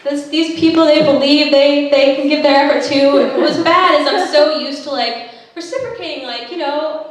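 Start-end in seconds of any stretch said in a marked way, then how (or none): none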